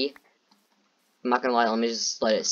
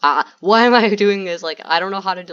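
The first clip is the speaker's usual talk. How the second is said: frantic; 7 semitones higher, 9 dB louder than usual. Real, not acted. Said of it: "I'm, like, terrified right now".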